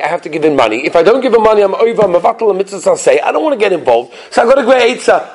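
normal talking voice

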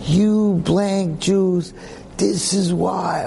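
mock furious shout at the shopkeeper; cursing missionary. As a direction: drag out the insult in a rage. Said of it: "started cursing me".

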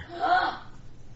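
sharp, high intake of breath; dramatic storyteller's gasp; shocked gasp. Acted. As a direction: gasp as if something precious is missing. Shock at finding it missing.

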